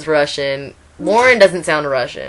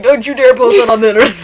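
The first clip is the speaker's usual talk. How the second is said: fast, higher, louder flustered warning; embarrassed dad; 6 semitones higher, 6 dB louder, faster than usual. Retold or imitated. Dad warning the boys not to post his photo, flustered and fast. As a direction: rattle it off like a mortified father. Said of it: "Like, all embarrassed".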